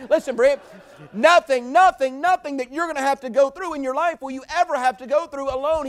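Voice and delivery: loud, high, driving preacher's cry; full-force preaching. Acted. Preacher urging Brent and the church, loud and high. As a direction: hammer it home to the congregation.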